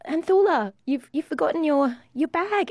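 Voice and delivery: re-enacted high call to get her attention. Customer to older lady who forgot her handbag, calling out.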